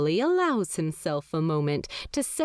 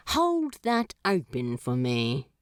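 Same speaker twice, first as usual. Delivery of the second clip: imperious; 6 semitones lower than usual. Acted. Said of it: "He gestures imperiously".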